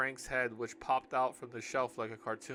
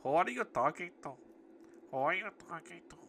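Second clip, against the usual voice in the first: higher-pitched put-on Indian accent; 4 semitones higher than usual. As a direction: try out an Indian accent for her. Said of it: "I'm gonna give her Indian voice".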